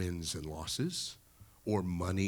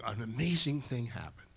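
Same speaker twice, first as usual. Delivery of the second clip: usual speaking voice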